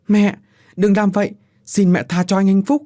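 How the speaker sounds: put-on high, loud begging girl's voice; pleading daughter. A narrator's reading. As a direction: beg her mother to spare him. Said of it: "Giọng của Diệu cầu xin". (Diệu cầu xin mẹ tha cho Phúc)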